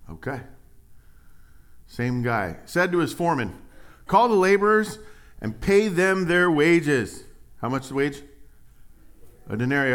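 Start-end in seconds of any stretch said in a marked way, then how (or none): 2.7-7.2 scripture reading. Acted. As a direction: proclaim the master's order with force. Preacher reading scripture aloud.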